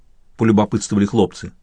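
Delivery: usual speaking voice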